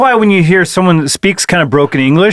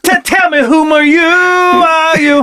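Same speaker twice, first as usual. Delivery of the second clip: mock dramatic demand; 10 semitones higher, slower than usual.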